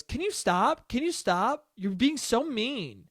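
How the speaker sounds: high, whiny imitation of mom; upset pleading. Mom begging dad to stop being mean, mock upset.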